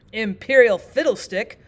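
loud, slow, grumpy retort; mildly irritated storybook king; a little annoyed. A narrator's reading. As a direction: scoff loudly, stretching the words. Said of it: "a little annoyed with the queen".

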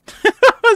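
high squealing laugh; loud laugh. Real, not acted. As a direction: burst out laughing. Laughs at teammate.

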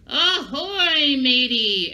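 hearty, loud pirate hail with a laugh; pirate greeting. Shown as a demonstration. Teacher showing kids a pirate hello, hearty and loud. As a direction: call it out like a jolly pirate. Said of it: "we don't say hello, we say".

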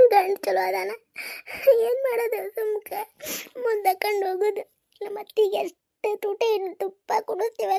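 high-pitched, weeping complaint; tearful lament. Acted. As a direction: pour out your troubles through tears. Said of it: "ಎದಕ್ಕೆ ಅಳಕತ್ತಿ ನೀನು". (ಅಳುತ್ತಾ ಗೋಳು ಹೇಳಿಕೊಳ್ಳುವುದು)

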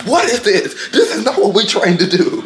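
high, loud mock coach yelling at his fighter; mock angry coach. Coach scolding his boxer, high mock fury. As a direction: play the furious coach half-laughing. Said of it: "If I was his coach, I'd be so mad".

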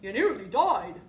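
loud, hefty warthog voice. A narrator's reading. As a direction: speak up as the warthog. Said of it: "said the warthog".

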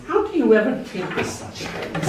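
mild fond exasperation; exasperated daughter. Retold or imitated. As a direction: roll your eyes at dad. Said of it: "she sighs".

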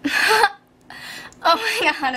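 laughing mid-question. Real, not acted. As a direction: crack up over the question. Breaks into laughter.

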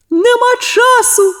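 very high, slow gruff brush-off. A narrator's reading. The worker in the hatch brushes Sonya off, gruff. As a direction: snap it back rudely, slowly. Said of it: "грубо відповіла луна".

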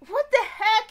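loud, high exclamation of disbelief; shocked. Real, not acted. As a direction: blurt it out in real shock. Shocked reaction to the scene.

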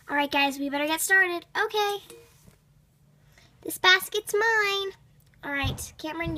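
ordinary talk, nothing marked

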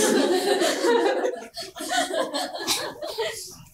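real high-pitched laugh. Real, not acted. Laughs at own story.

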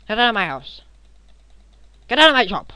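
mock angry shouting. Gamer yelling at intruders to get out, mock angry shout. Fending off enemies in game.

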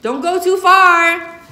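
high, loud, slow call down the street; calling after him. Acted. Mama calls after her son pedaling off. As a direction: call after the boy as he rides away. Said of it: "calls after him".

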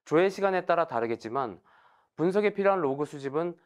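ordinary delivery

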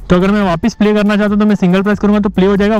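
usual speaking voice

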